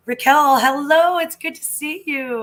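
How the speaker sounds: loud, high, happy hello; excited greeting. Real, not acted. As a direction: call out to a friend you're glad to see. Greeting a viewer on stream.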